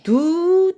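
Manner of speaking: 두 sung smooth and held; connected. Shown as a demonstration. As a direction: carry 두 over into the next note. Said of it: "연결을 한다".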